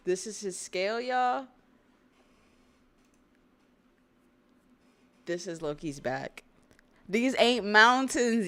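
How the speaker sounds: hyped out. Real, not acted. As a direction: yell at the viewers in disbelief. Hyped reveal of giant's scale.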